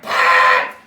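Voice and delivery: high piercing scream. Retold or imitated. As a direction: shriek the name. Screaming mid-throw.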